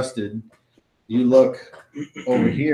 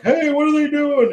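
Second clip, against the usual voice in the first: much higher, startled soldier; high elf soldier voice; 4 dB louder than usual. Acted. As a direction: squeak it like a confused soldier.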